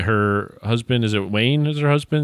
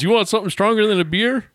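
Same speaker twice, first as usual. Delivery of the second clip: mock movie line; 11 semitones higher than usual. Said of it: "he goes".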